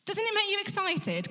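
preacher's high excited appeal. Real, not acted. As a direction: fire them up with a high rising plea. Repeated question much higher.

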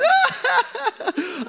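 high-pitched exaggerated sobbing wail; mock bawling wail. Preacher imitating his teenage self overcome at worship, mock bawling. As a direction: burst into hammed-up bawling. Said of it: "I just couldn't stop bawling".

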